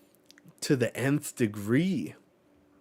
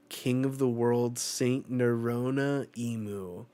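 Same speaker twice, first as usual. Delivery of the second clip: slow dramatic. Acted. Solemn reveal of a title.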